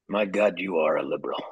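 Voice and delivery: low, soft impersonation of a friend; Chuck. Retold or imitated. Guest mimicking his host on the phone, low imitation. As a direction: drop the voice into a mock-grave verdict. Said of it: "the typical Chuck voice".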